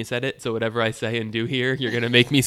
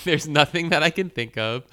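laughing; 6 semitones higher than usual. Real, not acted. Amused by own answer.

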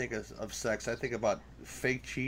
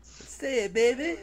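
squeaky high teasing voice; high mock voice; 12 semitones higher, slower than usual. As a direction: pitch way up and tease. Egging on with mock voice.

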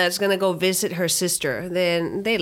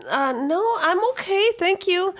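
a touch of an awkward aunt, pitched up; politely turning it down, a bit thrown; 12 semitones higher than usual. Acted. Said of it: "She says".

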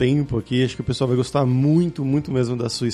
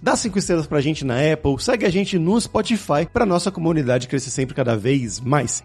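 lively, projected host sign-off and sponsor plug; podcast ad read; 6 semitones higher than usual. Acted. Podcast host plugs sponsor courses to listeners, upbeat ad read. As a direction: sell it with punchy, swooping announcer energy.

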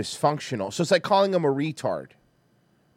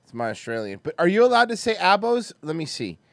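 normal talking voice

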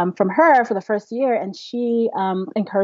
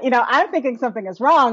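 usual speaking voice

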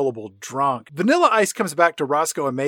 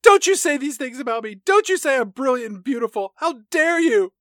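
high, indignant, overacted protest; mock outrage; 8 semitones higher than usual. Kat scolding her dad for complimenting her, in mock outrage. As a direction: play teen fury at being praised.

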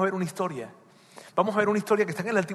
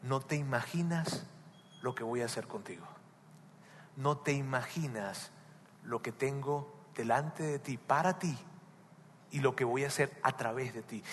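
tender voice of God; 4 semitones lower, 10 dB quieter than usual. Acted. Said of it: "y te diría".

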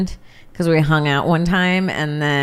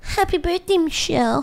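fancy card voice; 12 semitones higher than usual. Pretend. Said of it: "the card you put for Michelle's birthday".

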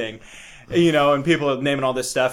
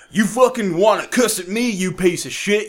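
higher, louder hammed-up flag-waving yell; mock patriot rant; 6 semitones higher, 7 dB louder than usual. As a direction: bellow like a cartoon patriot.

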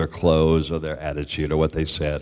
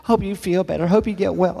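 higher, sugary get-well wishes; sweet fan voice; 11 semitones higher, 5 dB louder than usual. Retold or imitated. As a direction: read it like a doting fan. Quoting fan mail.

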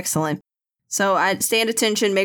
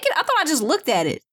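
surprised; 6 semitones higher, faster than usual. Real, not acted. Player doubts taking pad.

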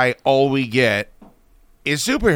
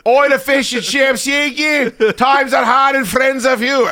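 squeaky high Cockney yell, slowing at the end; 13 semitones higher, 6 dB louder than usual. Retold or imitated. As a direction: shout it like a cartoon Cockney rocker, pitched way up. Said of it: "he's like".

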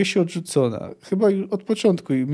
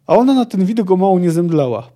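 snorted mockery, higher and louder; scornful snort; 4 semitones higher, 6 dB louder than usual. The elf scoffing at the girl to the band. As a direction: scoff it out with contempt. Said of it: "parsknęła elfka".

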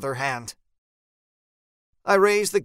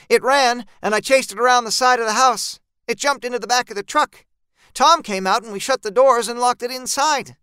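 higher, louder, hurried character voice; urgent eyewitness voice, raised and quick; Jean recounting the chase; 8 semitones higher, 5 dB louder than usual. Acted. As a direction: rush out what happened, pitched up.

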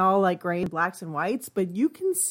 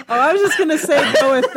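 spoken through a loud laugh, fast; 6 semitones higher, 7 dB louder, faster than usual. Real, not acted. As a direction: still laughing as the words come out. Laughing after the impression.

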